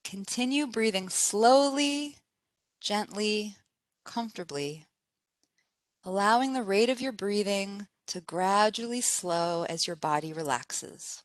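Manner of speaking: soft, unhurried meditation voice with spaced phrases; guided meditation. Acted. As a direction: guide them gently into relaxation, pausing between phrases.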